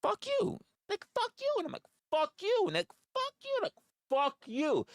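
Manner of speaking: high, repeated defiant "Fuck you"; mock defiance. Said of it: "And we're like".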